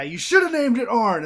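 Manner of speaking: high, loud mock indignation; pissed off. Arn Anderson before walking off, pissed off. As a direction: throw it out as an angry grievance. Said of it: "he is all pissed off".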